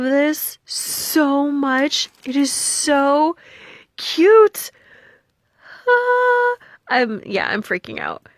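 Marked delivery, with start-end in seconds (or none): 4.0-6.5 high, loud squeal of delight; overjoyed squeal; excited squeal. Real, not acted. Squealing over the gift.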